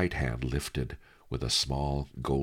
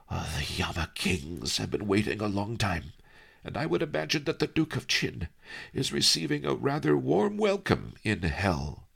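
lightly voiced wry old master, higher; a sly old scholar savouring the joke; lightly voiced old Master Li, amused; old sage Master Li; 7 semitones higher than usual. Li Kao voiced by reader.

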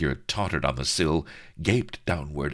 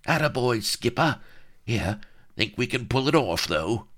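eager halfling; 7 semitones higher than usual. Acted. Halfling cheers captain.

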